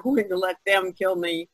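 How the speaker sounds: ordinary talk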